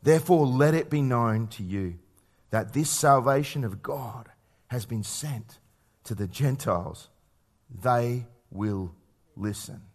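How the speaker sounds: slow, deliberate reading, dropping low and soft; scripture reading. Acted. Preacher closes the passage on the Gentiles, slow hushed scripture reading. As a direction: spell out the verse gravely, pausing between phrases. Reads scripture aloud to congregation.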